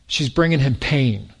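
normal talking voice

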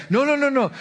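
high, loud imitated protest; imitating a lady who won't hear it; insistent objection. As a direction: cut him off with a high-pitched mock refusal.

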